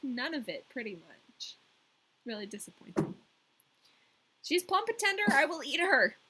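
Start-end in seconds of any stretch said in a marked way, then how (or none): 4.4-6.2 high, louder menacing queen; robber queen. A narrator's reading. Robber queen threatens Gerda again, high and loud. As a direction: relish eating the girl. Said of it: "said the robber queen".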